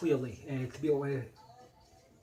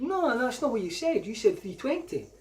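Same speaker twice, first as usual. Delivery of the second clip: indignant objection; 9 semitones higher, 4 dB louder than usual. Retold or imitated. Arguing price with shopkeeper.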